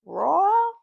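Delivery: mock Southern family calling "Royal", rising; imitated Southern-like accent. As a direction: holler "Royal" with a Southern twang, pitch swooping up. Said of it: "call him or pronounce his name".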